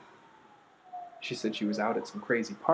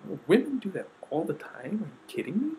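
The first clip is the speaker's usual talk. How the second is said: higher pitch, with an indignant "are you kidding"; incredulous retort; 8 semitones higher, 4 dB louder than usual. Retold or imitated. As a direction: fire back in disbelief. Retorting to people's claim.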